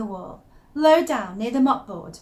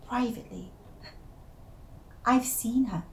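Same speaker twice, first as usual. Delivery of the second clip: much softer secretive murmur; conspiratorial hush; 8 dB quieter than usual. Acted. Telling the listener her secret sighting in a conspiratorial hush. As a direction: lean in and confide.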